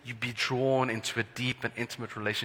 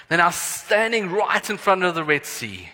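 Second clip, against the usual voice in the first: usual speaking voice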